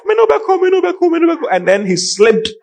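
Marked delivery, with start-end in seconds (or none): 0.1-1.5 high, repeated imitation of a wailing mourner; hammed-up grief chant, far above normal pitch; mock mourner's wail. Preacher retelling a man's cries at a burial, mock wailing. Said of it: "One guy was saying that".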